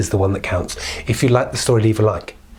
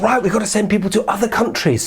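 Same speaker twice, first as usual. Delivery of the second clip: king's order; 10 semitones higher than usual. Acted. King ordering soldiers.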